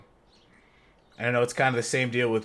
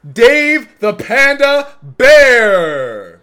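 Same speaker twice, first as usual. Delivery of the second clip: building to a loud, drawn-out hype call; show host intro; 8 dB louder than usual. Acted. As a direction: announce the guest like a ring introducer.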